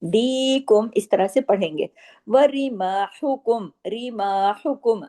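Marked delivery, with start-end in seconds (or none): 2.3-5.0 रिमाहुकुम slow with a long light मा; recited word. Shown as a demonstration. Teacher reciting to the class, slow. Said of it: "अलिफ मद्दा है".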